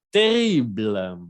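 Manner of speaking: Terrible spelled out slowly with a French r; slow model word. Shown as a demonstration. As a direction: drawl out Terrible syllable by syllable. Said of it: "slow model word before examples". (Terrible pronunciado despacio y claro)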